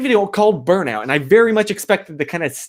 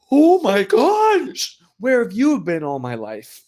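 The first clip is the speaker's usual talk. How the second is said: amazed; 5 semitones higher, slower than usual. Retold or imitated. First sip of tea, quoted.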